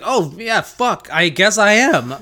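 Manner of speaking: high-pitched sheepish remembering; mock sudden realization. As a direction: act as if it just dawned on you. Harry suddenly remembers his task.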